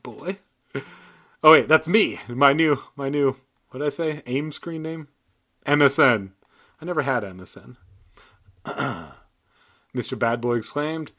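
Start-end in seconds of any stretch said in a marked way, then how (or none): none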